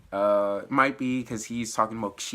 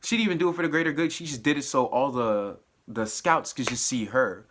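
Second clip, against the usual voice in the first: ordinary delivery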